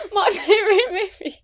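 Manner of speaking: talking through high laughter; laughing. Real, not acted. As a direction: laugh it out, pitch way up. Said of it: "this has the exact same energy".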